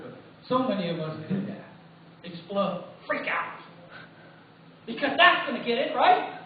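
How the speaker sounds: ordinary talk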